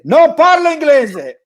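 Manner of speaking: mother repeating the same line but shouting it. Retold or imitated. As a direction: mom shouting her refusal in Italian. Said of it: "raising her voice".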